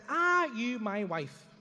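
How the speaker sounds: slow, wary, drawn out; slow suspicious. Retold or imitated. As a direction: drag it out warily.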